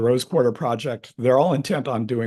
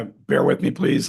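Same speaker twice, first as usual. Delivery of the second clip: ordinary talk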